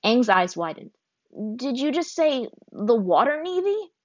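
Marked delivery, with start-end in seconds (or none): 1.6-3.9 higher, slower stunned question; the news knocks the air out of him; shocked disbelief. A narrator's reading. Aang hears of the Water Navy, stunned. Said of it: "His voice and his legs felt weak".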